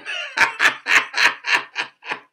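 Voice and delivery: high shouted burst of laughter. Real, not acted. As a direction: let a big laugh burst out. Laughs at own joke.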